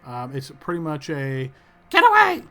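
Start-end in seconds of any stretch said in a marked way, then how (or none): 1.9-2.4 shrill short Draconic shout; high-pitched creature yelp. Acted. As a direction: yip it out, high and sharp. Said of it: "high-pitched and short".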